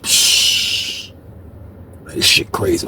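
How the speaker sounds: soft breathy scoff; hushed low scoff; whispered disgust. Retold or imitated. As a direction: say it under the breath, stunned. Muttering in disbelief at dinner.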